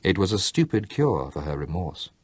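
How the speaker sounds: usual speaking voice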